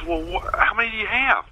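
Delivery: lightly imitating a baffled questioner, pitched high; mock amazed questioning. Gullible writer quizzing the prankster, mock amazed.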